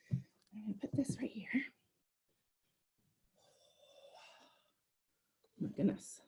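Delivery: hushed whisper aside. Real, not acted. Librarian whispering while moving closer to children. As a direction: whisper as if sharing a secret.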